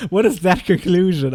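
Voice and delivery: real laughing. Real, not acted. Laughs at the video's conclusion.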